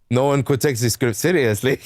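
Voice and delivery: halting, hammed-up French accent; fake French accent. Game company answers why there are no French voices, fake French accent. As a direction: put on a bad French accent. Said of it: "my fake-ass French accent".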